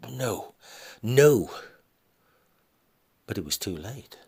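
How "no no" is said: high and loud, the last no shouted; husband cuts off the argument; forceful no. Acted. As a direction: slam the door on the idea. Husband refusing to stay.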